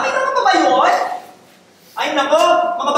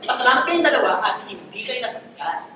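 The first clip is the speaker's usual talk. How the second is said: normal talking voice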